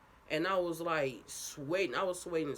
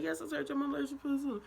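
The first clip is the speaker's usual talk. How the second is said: fast answer; 10 semitones higher, faster than usual. Retold or imitated. Retold frantic answer.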